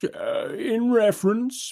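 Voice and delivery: high, slow judge's voice; hesitant judge. A narrator's reading. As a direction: ease into the ruling. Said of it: "proceeds the Chancellor".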